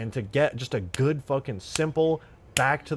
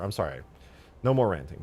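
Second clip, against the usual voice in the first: ordinary talk, nothing marked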